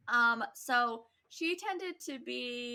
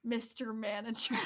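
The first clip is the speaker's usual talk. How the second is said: ordinary talk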